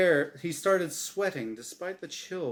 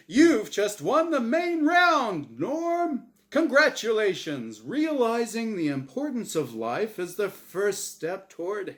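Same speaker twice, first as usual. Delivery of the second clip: game show announcer; 9 semitones higher, 7 dB louder than usual. Acted. Azrael hyping Norm's win to the crowd, big announcer voice. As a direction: boom it out like a TV prize reveal.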